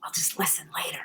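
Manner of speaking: half-whispered aside, as if covering her ears; playful whisper. Real, not acted. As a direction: whisper it jokingly to herself. Joking she'll not hear spoiler.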